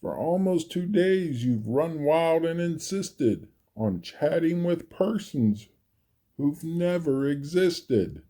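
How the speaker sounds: lightly voiced scolding kangaroo; snapping kangaroo. A narrator's reading. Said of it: "snapped the big kangaroo".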